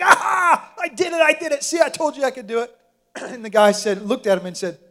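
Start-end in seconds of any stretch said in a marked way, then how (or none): 0.0-2.8 much higher, loud victorious cry; triumphant burst. Retold or imitated. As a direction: explode out of the water crowing.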